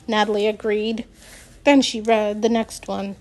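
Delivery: plain reading voice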